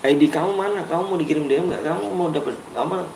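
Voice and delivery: hushed, leaning in to a little one; gentle hushed question. Real, not acted. Talking softly to child.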